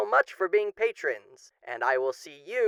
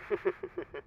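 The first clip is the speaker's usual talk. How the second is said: soft sinister chuckle; 9 dB quieter than usual. Acted. After threatening to claim soul.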